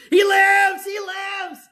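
high, loud shout of praise; joyful shout. Retold or imitated. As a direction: shout it across the river. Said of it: "And I was screaming it out".

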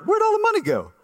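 high-pitched, comically baffled question; mock bewilderment. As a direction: squeal it like someone baffled by an empty account. Acted bewildered payday reaction.

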